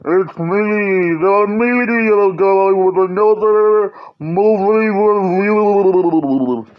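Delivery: slow, distant underwater voice. Pretend. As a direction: speak as if submerged. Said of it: "that underwater voice".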